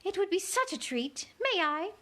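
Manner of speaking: high, cooing queen. Acted. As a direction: coo it sweetly like a pigeon. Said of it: "like the cooing of a pigeon".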